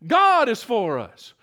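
emphatic, measured GOD; stress on GOD. Shown as a demonstration. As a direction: lean slowly on God, then let the rest fall. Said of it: "emphasize that word in your mind".

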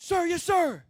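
loud, high boot-camp response; recruit's shouted reply. Retold or imitated. Recruit answering the series commander, shouting. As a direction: sound off to a superior.

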